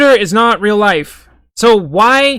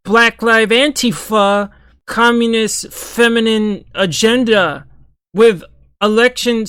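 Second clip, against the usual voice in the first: mocking buzzword tweet; 3 semitones higher, slower than usual. Parodying Candace Owens' tweet as a slow, mocking buzzword drone. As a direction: drag out each buzzword like a parody of a ranting post. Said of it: "Her tweet might as well have been".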